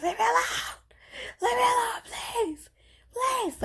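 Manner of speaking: high, tearful wailing leave me alone; crying plea. Retold or imitated. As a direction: sob it out, begging to be left alone. Crying in car to family.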